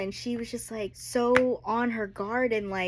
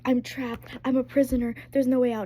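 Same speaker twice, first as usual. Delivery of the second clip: louder, rising panic; panicked quote; 5 semitones higher, 5 dB louder than usual. Retold or imitated. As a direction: build the panic line by line. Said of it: "almost having a panic attack".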